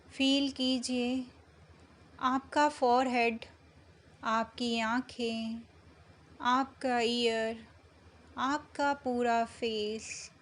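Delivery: slow meditation voice with long pauses between phrases; unhurried, measured meditation guidance; guided meditation. Acted. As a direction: guide them gently into calm, drawing out the words, phrase by phrase.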